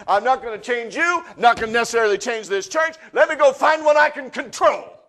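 loud, high, driving sermon voice; preaching at full force. Acted. Pastor challenging his congregation, full-force loud preaching. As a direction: preach it hard to the back row.

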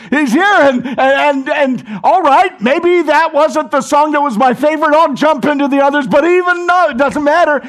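loud, high, exuberant proclaiming; full-force preaching. Real, not acted. Pastor shouts joyfully to the gathered church. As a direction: shout it with joy. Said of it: "we say".

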